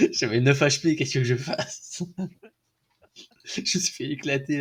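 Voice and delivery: laughing through the words; chuckling while talking. Real, not acted. Laughing after dying in game.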